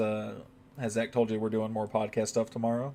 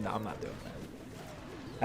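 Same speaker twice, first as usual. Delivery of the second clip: muttered refusal; 3 semitones lower, 13 dB quieter, much slower than usual. Real, not acted. Refusing to cry on stream.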